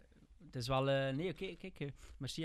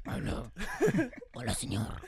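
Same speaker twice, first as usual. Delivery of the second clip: deep pitch shifted; 4 semitones higher, 4 dB louder, slower than usual. Pretend. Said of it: "hij is een beetje een stemvervormer".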